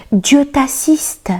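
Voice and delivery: high, drawn-out cry; calling out. A narrator's reading. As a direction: call out a blessing, high and slow without shouting. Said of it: "lui cria". (crier une bénédiction, voix haute et lente)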